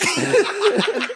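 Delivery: high airy burst of laughter; cracking up at his own story. Real, not acted.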